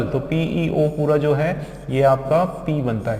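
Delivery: normal talking voice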